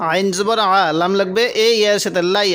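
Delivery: ordinary talk, nothing marked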